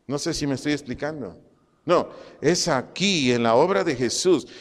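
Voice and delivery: ordinary talk, nothing marked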